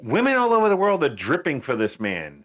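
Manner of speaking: gushing female fans. Retold or imitated. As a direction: lightly imitate besotted women, voice lifted. Acting out girls' gushing messages.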